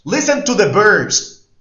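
loud, high, slow call to the class; loud announcement. Shown as a demonstration. Teacher loudly announcing the next exercise to students. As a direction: call the instruction out to the whole class. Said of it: "teacher announcing exercise".

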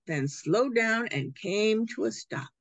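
plain reading voice

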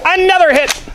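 high, loud triumphant cheer; excited shout. Real, not acted. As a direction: shout it out happily. Cheering another hit.

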